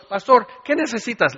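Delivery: eager helper. Acted. Eager churchgoer fawning on pastor.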